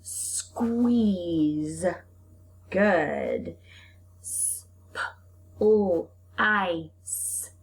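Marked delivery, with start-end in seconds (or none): 4.2-7.5 sounding out. Shown as a demonstration. Teacher segmenting a word for the class. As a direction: split into separate sounds. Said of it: "how many sounds".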